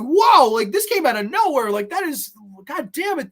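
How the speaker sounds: high, taken-aback outburst; shocked reaction. Acted. Reviewer reacting to sudden meanness, shocked.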